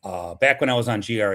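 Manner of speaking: ordinary talk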